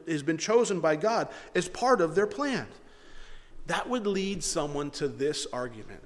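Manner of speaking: ordinary talk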